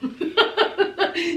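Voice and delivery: rapid run of chuckles; real laugh. Real, not acted. Laughing at the man crying over his song, chuckling. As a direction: crack up at your own imitation. Laughs at her own imitation.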